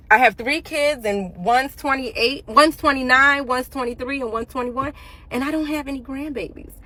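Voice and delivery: ordinary delivery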